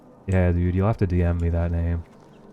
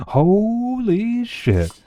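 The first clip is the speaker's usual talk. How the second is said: very slow, much higher holy shit, wailed out; laughing amazement at a friend's news; stretched disbelief; 3 dB louder, much slower than usual. Real, not acted. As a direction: drag it out in a squeaky wail of shock.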